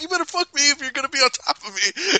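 high, quick acted insistence; mock demand. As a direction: rattle off a bickering ultimatum. Acting out bedroom fight quarrel.

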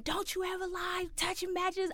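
high-pitched mock dad tirade; imitated scolding dad. Dad scolding while whipping the child, imitated in high voice. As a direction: put on a high, scolding parent voice. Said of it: "he's whooping my ass".

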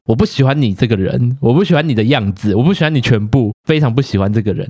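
ordinary talk

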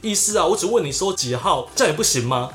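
a caught clerk hurrying to deny it; flustered clerk. Retold or imitated. Clerk denying to the angry customer, high and panicky. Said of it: "就很慌张说".

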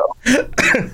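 short high-pitched laugh. Real, not acted. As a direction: burst into a quick high laugh. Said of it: "you got me laughing".

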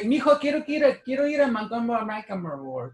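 lightly voiced mom mangling the store name; a hint of Mom asking for the store; imitating mom. Son recalls mom asking to go, lightly imitated. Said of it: "I couldn't pronounce it".

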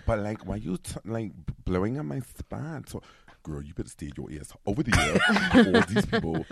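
sassy New York street-woman character; sassy character putting someone in their place. Acted. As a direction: tell someone off in a put-on street voice. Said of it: "this is one of the characters. I do these two characters".

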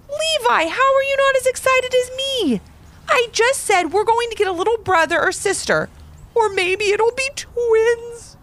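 put-on high little girl, beaming and pleading; big smile. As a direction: coax your brother to be excited. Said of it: "Ivy said with a huge smile".

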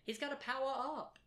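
high, hyped fan voice; excited. Acted. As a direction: get pumped about powering up. Excited Goku defense.

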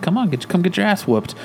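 high, goading dare; taunting challenge. Retold or imitated. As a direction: egg him on to fight. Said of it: "And he's like".

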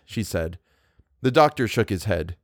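usual speaking voice

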